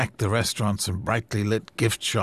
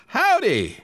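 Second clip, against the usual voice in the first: a high, bright Western "Howdy!" called out; 5 dB louder than usual. Acted. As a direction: call "Howdy!" like a cowboy greeting. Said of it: "Mel called back, anticipating a Western accent".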